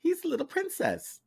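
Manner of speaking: very high-pitched mock cutesy voice; teasing coo. Co-host teasing the sensitive absent friend, high mock coo. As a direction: coo it high, poking fun.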